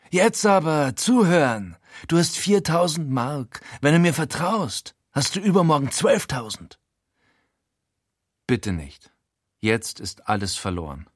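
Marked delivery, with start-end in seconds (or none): 0.1-6.8 higher, urgent con-man voice; Frankie's sales pitch. A narrator's reading. Frankie pitching a scheme to his friend, urgent. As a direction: sell the dream, pitched up.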